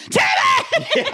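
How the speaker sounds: a high, laughing yell of the dog's name; high laughing yell across the dog park; laughing shout. Retold or imitated. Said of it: "she's like".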